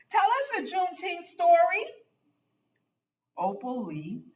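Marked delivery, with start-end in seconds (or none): 0.1-1.8 put-on high, quick child's plea; a kid begging for a story; eager child. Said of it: "Buddy called".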